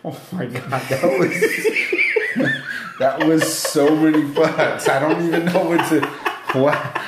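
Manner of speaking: talking through laughter; giggling at all the swearing; laughing. Real, not acted. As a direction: laugh out the words in disbelief. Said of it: "laughing at the swearing".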